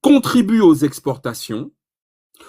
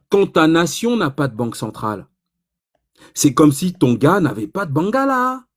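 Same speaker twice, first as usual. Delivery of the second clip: ordinary talk, nothing marked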